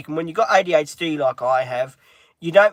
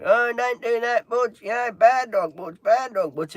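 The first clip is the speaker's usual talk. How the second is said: imitating mum yelling; 7 semitones higher than usual. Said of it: "Mum was really".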